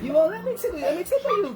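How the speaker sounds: high, playful coaxing with a laugh; playful teasing. Real, not acted. Mom teasing her child during a tickle game, playful and high.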